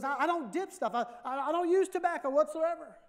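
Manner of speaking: put-on high, loud flustered old lady denying it; indignant grandma. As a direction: sputter the denial up high like a caught grandma. Grandma denies dipping snuff.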